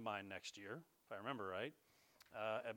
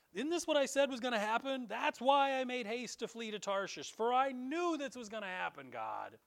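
higher and louder, exasperated; angry complaint; 8 semitones higher, 7 dB louder than usual. Retold or imitated. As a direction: vent at God, fed up. Jonah complains to God.

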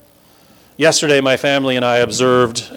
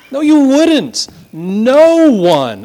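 much higher and louder, forceful denial; emphatic rebuttal; 10 semitones higher, 6 dB louder, much slower than usual. Real, not acted. Preacher rebutting skeptics from the pulpit, loud and high. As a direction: hammer the rebuttal home. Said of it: "second, louder rebuttal".

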